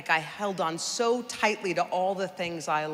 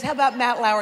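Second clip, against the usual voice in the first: eager stranger; 6 semitones higher, 10 dB louder, faster than usual. Retold or imitated. Stranger keeps asking questions.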